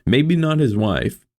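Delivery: ordinary delivery, nothing marked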